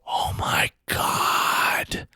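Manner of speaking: slow, stunned whisper; awed whisper. Retold or imitated. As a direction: breathe it out slowly in disbelief. Star spotted next to him.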